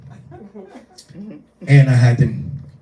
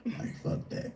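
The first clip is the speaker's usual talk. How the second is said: muttered aside; 16 dB quieter than usual. Retold or imitated. Muttering under breath in retelling.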